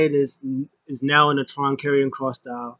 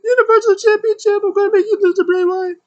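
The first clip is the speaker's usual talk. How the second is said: very high, loud squeaky falsetto; mock female voice, squealing threats; Alexa Bliss; 8 dB louder than usual. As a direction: rant in a hammed-up shrill falsetto, vowing revenge. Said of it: "And Alexa Bliss is like".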